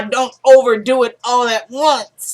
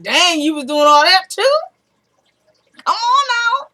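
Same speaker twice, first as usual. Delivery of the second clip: disbelieving; 8 semitones higher than usual. Retold or imitated. Viewer reacting to the drug revelation, disbelieving. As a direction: rise high on a scolding disbelief.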